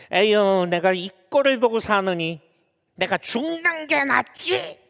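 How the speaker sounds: acted weary grumble climbing high; exasperated wife. Wife grumbles beside her good-for-nothing husband, acted exasperation. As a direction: sigh in, then stretch the last complaint upward. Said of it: "남편들이 옆에서 말".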